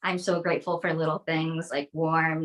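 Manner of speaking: normal talking voice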